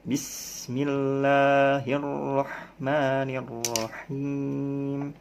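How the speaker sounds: Bismillahirrahmanirrahim drawn out very slowly; unhurried recitation. Shown as a demonstration. Teacher to students, slow careful recitation. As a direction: stretch each sound of Bismillahirrahmanirrahim. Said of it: "jangan buru-buru".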